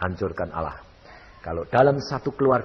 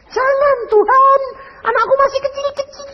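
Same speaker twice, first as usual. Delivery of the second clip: high, crying plea to God, words breaking; tearful plea; 4 dB louder than usual. Retold or imitated. Dying wife begging God to spare her, crying high. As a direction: beg God through tears for the little children.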